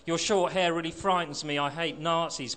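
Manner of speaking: plain reading voice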